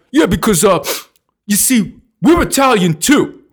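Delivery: South Philly Italian. Retold or imitated. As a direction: put on a swaggering Philly Italian. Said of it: "picture your typical South Philly Italian".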